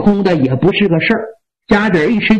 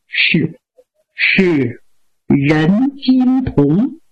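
low, halting wounded voice; weakened by injury; 5 semitones lower, much slower than usual. Acted. Wounded general naming his attacker to his master, weakly and haltingly. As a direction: drag the words out weakly, with pauses.

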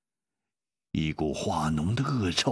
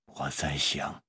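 low, unhurried deadpan; deadpan musing; 7 semitones lower, 4 dB quieter than usual. A narrator's reading. Yue Yang answers nervous companion, low deadpan. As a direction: muse it in a low, quiet voice.

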